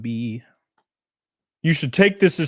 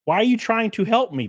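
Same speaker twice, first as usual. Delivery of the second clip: normal talking voice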